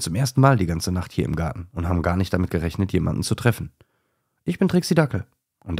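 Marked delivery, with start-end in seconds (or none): 4.4-5.3 lightly voiced small dachshund, bright and friendly; high little dog voice. A narrator's reading. Small dachshund introducing herself in a high voice.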